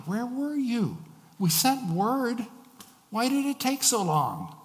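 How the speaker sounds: high, pained accusation; accusing. Acted. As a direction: demand answers, voice climbing high. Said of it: "sound like an accusation".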